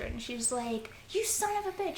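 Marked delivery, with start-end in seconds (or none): 1.1-2.0 high-pitched mock scream, held back in volume; screaming in outrage. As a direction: imitate a girl cursing a soldier. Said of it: "Nora's screaming at the soldier".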